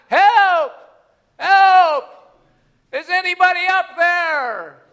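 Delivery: high, loud cry for help from below a cliff; hanging off a ledge, shouting up for rescue; calling for help. Acted. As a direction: yell up desperately to anyone above. Man hanging from cliff calls.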